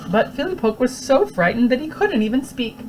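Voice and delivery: ordinary delivery, nothing marked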